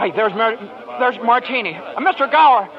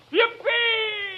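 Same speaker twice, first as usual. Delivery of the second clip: high ecstatic yippee; joyful whoop; 9 semitones higher, 6 dB quieter than usual. Acted. George whooping as he runs home. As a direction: whoop with pure joy.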